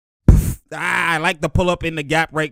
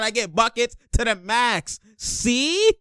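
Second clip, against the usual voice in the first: high mock catchphrase, stretched at the end; playful high-pitched brag; Maxey; 6 semitones higher, slower than usual. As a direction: imitate a player's boast in a high voice. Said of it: "Maxey said".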